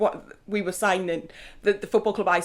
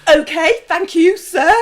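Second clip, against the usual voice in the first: flustered polite reply; 8 semitones higher, 8 dB louder, slower than usual. Retold or imitated. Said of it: "I was just like".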